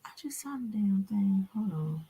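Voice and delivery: whispered to herself; hushed aside. Real, not acted. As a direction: breathe it out, voiceless. Spots something on screen.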